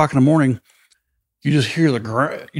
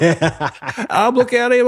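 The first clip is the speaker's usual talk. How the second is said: high, slow, through laughter; laughing; 11 semitones higher, much slower than usual. Real, not acted. Telling the gas mask story while laughing hard. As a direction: let the laugh lift the words.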